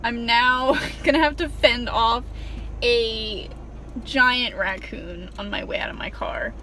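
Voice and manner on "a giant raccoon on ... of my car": ordinary delivery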